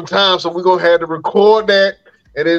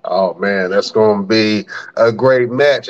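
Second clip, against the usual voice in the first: normal talking voice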